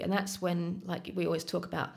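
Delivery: ordinary talk, nothing marked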